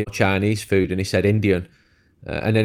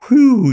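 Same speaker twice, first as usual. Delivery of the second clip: imitated big exhale of relief, high and loud; relieved whew; 13 semitones higher, 7 dB louder than usual. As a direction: let out a mock 'whew' as tension drops. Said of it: "you felt him relax".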